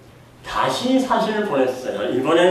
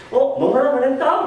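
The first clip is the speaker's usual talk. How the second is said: much higher, louder, laughing delight; gleeful gotcha; 11 semitones higher, 4 dB louder than usual. Retold or imitated. Son catching dad's careless permission, higher gleeful laughing. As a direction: pounce on the loophole like a kid.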